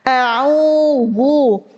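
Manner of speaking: ordinary talk, nothing marked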